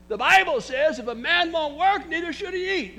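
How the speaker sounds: high, loud preaching that keeps climbing; full-force preaching. Acted. Pastor warning his church, preaching at full force. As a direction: preach it hard to the pews.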